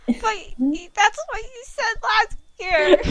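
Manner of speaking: high, loud wailing complaint; crying child. Acted. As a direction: wail it like a let-down kid. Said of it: "He cried".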